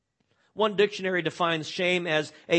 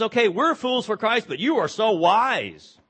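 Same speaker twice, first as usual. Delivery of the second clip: sarcastic; 5 semitones higher, 6 dB louder than usual. Retold or imitated. Paul mocking the Corinthians, sarcastic. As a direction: lay on the sarcasm with "so wise". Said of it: "a tinge of sarcasm here".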